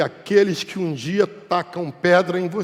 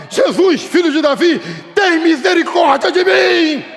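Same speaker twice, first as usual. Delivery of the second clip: loud, high cry for mercy; shouted plea; 12 semitones higher, 8 dB louder than usual. Acted. Blind man who keeps crying out to Jesus, shouted plea. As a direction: bellow it, stretching the last phrase. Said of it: "Eu continuo gritando".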